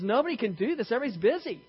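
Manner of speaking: high-pitched exasperated complaint. Retold or imitated. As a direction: act out a woman at the end of her patience. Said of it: "finally she's just exasperated".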